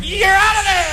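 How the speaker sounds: race marshal shout. Acted. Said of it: "two little flags in the operating room going".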